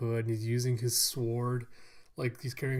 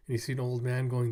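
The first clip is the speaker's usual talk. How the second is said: ordinary talk, nothing marked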